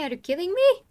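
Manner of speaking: high, loud disbelief; incredulous outburst. Real, not acted. Guest told he sounds condescending, bursts out in disbelief. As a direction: jump up in pitch, genuinely taken aback.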